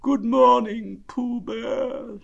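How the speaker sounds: gloomy, slow, high donkey voice; Eeyore. A narrator's reading. Donkey answering Pooh's greeting, gloomy. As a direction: drag the greeting out dolefully. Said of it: "said Eeyore gloomily".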